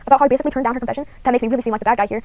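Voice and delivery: ordinary delivery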